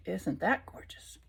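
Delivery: hushed unvoiced admiration; whisper. Real, not acted. Admiring the parrot card, a hushed whisper. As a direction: breathe it in awe.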